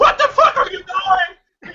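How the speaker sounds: high, loud, slow shout breaking into a laugh; outraged yell. Acted. Driver yells at the man hauling him out, high shout with laughter. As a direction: yell in disbelief as you're dragged out. Driver dragged from his car.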